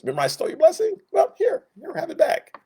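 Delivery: high, light mock-sheepish voice; Jacob giving back. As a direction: hand it back like a guilty little brother. Jacob voiced returning the blessing.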